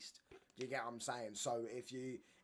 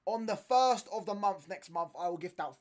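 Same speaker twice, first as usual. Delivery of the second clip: excited announcement; 6 semitones higher, 10 dB louder than usual. Real, not acted. Announcing the membership giveaway.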